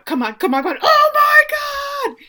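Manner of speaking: loud, high re-enacted screaming; excited kids cheering. Kids watching the goal go in, building to screams.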